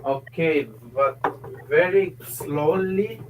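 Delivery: put-on deliberate Finnish-accented English; mock Finnish accent. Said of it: "How to do the Finnish accent?".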